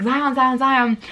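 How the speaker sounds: mom trying to get his attention; mild mock call across the street. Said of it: "my mom was like".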